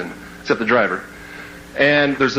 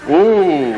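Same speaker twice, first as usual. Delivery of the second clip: disgusted ooh; 2 semitones higher, 3 dB louder than usual. Retold or imitated. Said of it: "backing up and going".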